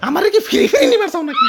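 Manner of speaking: high-pitched indignant protest; angry outburst. Acted. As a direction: burst out, offended and incredulous. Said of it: "ইয়া রাগ কইরো না".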